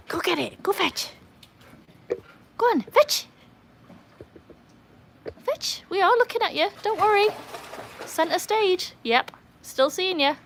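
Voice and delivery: high, coaxing voice to the dogs; high-pitched dog talk. Real, not acted. Talking to the dogs, urging fetch.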